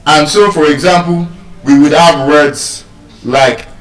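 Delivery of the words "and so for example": usual speaking voice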